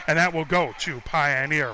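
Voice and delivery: ordinary delivery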